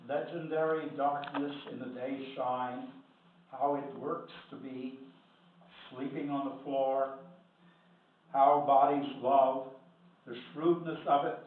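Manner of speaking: measured poem recital with deliberate pauses; poetry reading. Acted. Poet reading a poem to an audience, measured recital. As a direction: recite line by line, stretching key phrases. Reads second poem aloud.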